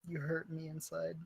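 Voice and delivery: very soft, low aside; mock wounded. Player to game master after a setback, mock-hurt murmur. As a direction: say it small, pretending to be hurt.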